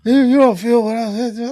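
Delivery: shrill protest, hammed up; imitating Johnson. Punched buddy protesting to Grundy, high loud mock whine. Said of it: "He's like".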